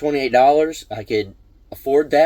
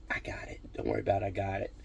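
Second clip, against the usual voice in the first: breathy whisper, barely voiced, far softer; hushed whisper; 3 semitones lower, 14 dB quieter, faster than usual. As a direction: whisper it discreetly to the cashier so no one hears. Quietly covering a stranger's bill.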